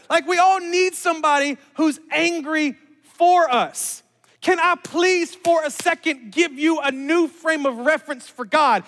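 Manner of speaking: loud, high, emphatic sermon delivery; impassioned preaching. Real, not acted. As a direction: preach it slowly and forcefully, punching key words. Preaches at full force.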